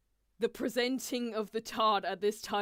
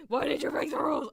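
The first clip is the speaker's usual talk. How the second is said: louder, higher accusing demand; mock angry Riddle; 7 semitones higher, 8 dB louder, faster than usual. Riddle confronting Ace over the tart, mock angry demand. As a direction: snap the question at him. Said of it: "Riddle confronting Ace".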